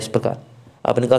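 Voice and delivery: ordinary talk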